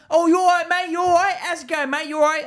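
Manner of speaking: high, loud matey greeting; mimicked pushy lad. Mocking lads who come along to him, high and loud. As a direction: put on an over-eager lad, pitched right up.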